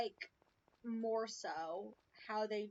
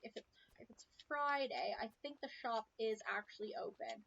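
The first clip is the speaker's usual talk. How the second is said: ordinary talk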